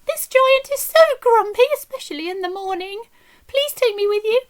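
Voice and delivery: a high, begging little harp; pleading harp. A narrator's reading. Harp begging Jack at the doorway, high and pleading. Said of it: "said the harp".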